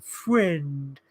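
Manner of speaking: one long drawn-out word; mocking, slow wizard impression; Gandalf. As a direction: stretch it out like a dim, halting stranger. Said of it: "now we've got Gandalf going".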